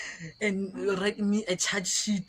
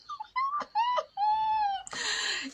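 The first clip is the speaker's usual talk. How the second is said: real high-pitched wheezing laugh; long squealing laughter; high drawn-out laugh. Real, not acted. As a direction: crack up in one long high peal. Laughs at the absurdity.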